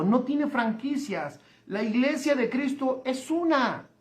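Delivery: ordinary talk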